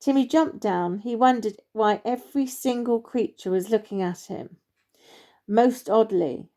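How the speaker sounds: ordinary delivery, nothing marked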